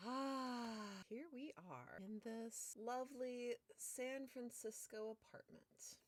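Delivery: scene setting. Acted.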